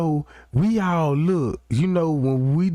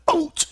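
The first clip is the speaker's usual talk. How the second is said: sudden high-pitched "ouch"; imitated pained yelp. Inmate crying out in a jail fight, mock yelp. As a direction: yelp like you just got punched.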